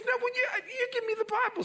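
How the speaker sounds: very high, fast, pleading; a girl insisting she can read; eager insistence. Acted. Daughter insisting in story.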